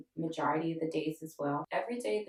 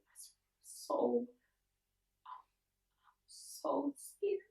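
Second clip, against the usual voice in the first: high, halting, breaking into whisper; scared, overcome; 9 semitones higher, 4 dB quieter, much slower than usual. Real, not acted. Stroke survivor relives the frightened night, overcome. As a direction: struggle to get the words out, slowly.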